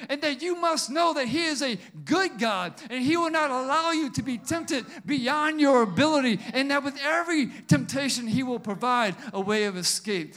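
loud, rising preacher's exhortation; preaching. Acted. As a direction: preach it with fire. Preaching at full force.

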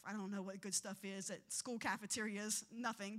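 low intimate sermon aside; quiet storytelling. Acted. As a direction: draw them in with a hushed anecdote.

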